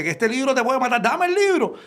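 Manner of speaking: high, insistent demand, dame acá el libro; snatch it like a man who wants the prize. Retold or imitated. Man demanding the book from the preacher, high and insistent.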